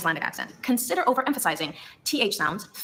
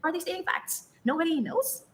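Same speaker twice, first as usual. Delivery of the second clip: "stating facts" and "Nobody knows" pitched high, lifting; Icelandic upspeak; 7 semitones higher, 4 dB quieter than usual. Shown as a demonstration. Narrator demonstrating the accent to learners, Icelandic upspeak. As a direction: make "stating facts" sound like a question. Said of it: "upward inflection".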